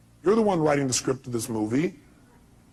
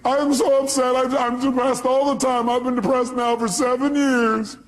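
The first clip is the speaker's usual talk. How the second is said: high, loud, slow whiny woman; hammed-up pleading caller; caller's voice; 11 semitones higher, 7 dB louder than usual. Caller pleading with Robbins on radio, high whine. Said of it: "she goes".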